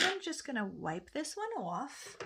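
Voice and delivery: slipping into a New York accent; accidental New York accent. Real, not acted. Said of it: "There we go with the accent again".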